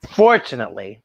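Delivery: usual speaking voice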